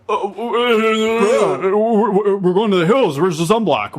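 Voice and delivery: high mocking sing-song impression of a fussy partner; mock him in a high sing-song voice.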